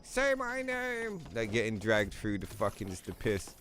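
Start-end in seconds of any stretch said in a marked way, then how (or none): 0.0-1.2 high, drawn-out desperate plea; mock desperate plea, slow and high; pleading beggar. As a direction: stretch it out like a pitiful wretch in the mud. Dragged man begging.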